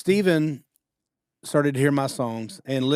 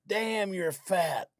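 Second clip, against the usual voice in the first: random guy's insult; 6 semitones higher, 5 dB quieter, much slower than usual. Retold or imitated. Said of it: "He goes".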